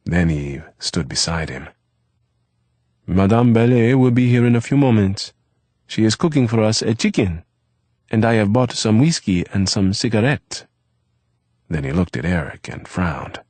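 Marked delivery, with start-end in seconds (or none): 3.1-10.6 lightly voiced young French boy, higher; Eve's voice. A narrator's reading. Eve to Eric about dinner, lightly voiced boy. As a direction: Eve brightly telling Eric the plans.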